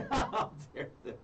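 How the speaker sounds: high, slow, laughing through it; laughing sarcasm. Retold or imitated.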